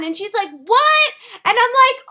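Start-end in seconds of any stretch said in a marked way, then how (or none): 0.5-1.1 loud, high, can't hear you; shouted what. Retold or imitated. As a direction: yell it over the noise. Friend can't hear over music.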